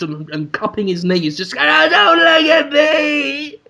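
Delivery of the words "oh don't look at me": loud, drawn-out anguished wail; Willem Dafoe. Acted. Boss huddled in the corner, loud anguished wail. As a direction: wail it in hammed-up humiliation. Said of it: "Willem Dafoe is huddled in the corner".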